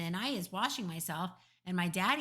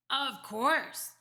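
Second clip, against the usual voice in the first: higher, louder giant's reply; emphatic giant; 6 semitones higher, 5 dB louder than usual. A narrator's reading. BFG answers Sophie's question, emphatic.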